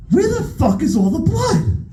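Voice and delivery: high, panicked question; nervous, high-pitched alarm; alarmed disbelief. Retold or imitated. No blood after tooth pulled.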